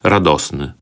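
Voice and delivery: ordinary delivery, nothing marked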